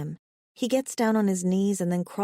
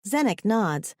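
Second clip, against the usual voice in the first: usual speaking voice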